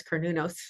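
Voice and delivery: Cernunnos stressed on the second syllable. Shown as a demonstration. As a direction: say Cernunnos as ker-NOO-nos. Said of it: "with the accent on the second syllable".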